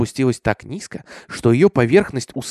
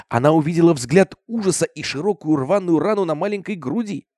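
ordinary delivery, nothing marked

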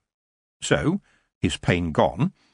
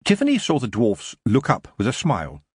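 usual speaking voice

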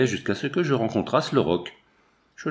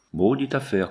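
plain reading voice

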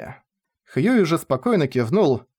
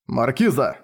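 ordinary delivery

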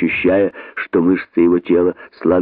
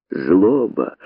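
ordinary delivery, nothing marked

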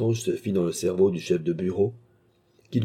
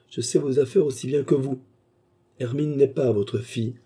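higher-pitched baronet, pressing home; 6 semitones higher than usual. A narrator's reading. Baronet reveals the girl is not his daughter, higher and insistent. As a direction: lean hard on n'est, as if exposing the secret.

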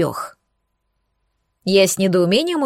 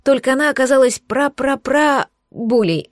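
ordinary delivery, nothing marked

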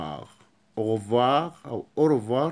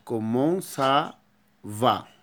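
very slow كومون سافا; very slow teaching repeat; slow demo; much slower than usual. Shown as a demonstration. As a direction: drag each syllable out. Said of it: "slow repeat for class".

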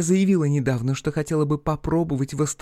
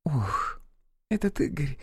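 softly spoken with weary disgust; distaste; 6 dB quieter, slower than usual. Acted. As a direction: sigh out the name with distaste. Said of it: "Ирина поморщилась, произнося его имя".

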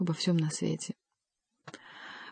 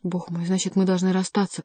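ordinary delivery

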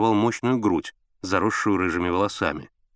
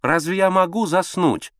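high, drawn-out whine; petulant, slow and high-pitched; capricious; 9 semitones higher, 4 dB louder than usual. A narrator's reading. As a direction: complain like a spoiled wife. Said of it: "капризным голосом ответила Алла Сергеевна".

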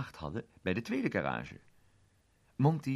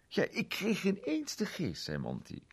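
Monty-stem; 8 semitones higher, 4 dB louder than usual. Acted. Monty citeert zijn handleiding.

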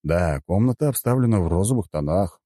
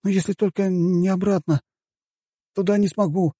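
put-on high-pitched young man's voice; high, boyish character voice; hesitant lad; 12 semitones higher than usual. A narrator's reading. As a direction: explain it high, as an unsure youngster. Same sleepy lad answers the commander.

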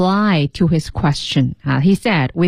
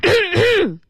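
demonstrative, drawn-out throat clear; forceful throat-clearing; 14 semitones higher than usual. Shown as a demonstration. Co-host demonstrating the girl's attention-seeking noise, deliberate throat-clearing. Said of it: "就是这样".

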